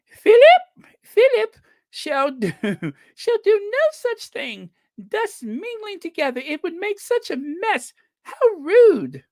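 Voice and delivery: very high, prim and scandalised royal voice; hammed-up Queen Elizabeth II. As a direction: do a bad, squeaky-high impression of a monarch. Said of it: "the worst Q E two accent".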